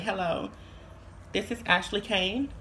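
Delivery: soft, feminine business-call voice; politely put-on feminine phone manner; sisters' phone voice. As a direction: imitate your sister calling about a bill. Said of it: "'Cause that's how my sisters sound".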